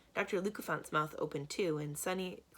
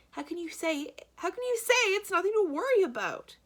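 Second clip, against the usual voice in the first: very high, louder frantic protest; a banker shrieking at the calm man; panicked outrage; 14 semitones higher, 9 dB louder than usual. A narrator's reading. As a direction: squeal the protest. Poe panicking at Stefano.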